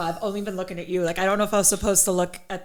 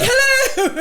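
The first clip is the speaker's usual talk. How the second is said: mock magician greeting; 3 dB louder than usual. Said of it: "He's like".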